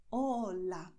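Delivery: "Hola" with pure Spanish O and A; native Spanish pronunciation. Shown as a demonstration. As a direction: say "Hola" with silent H, unglided vowels. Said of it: "they sound just like you heard".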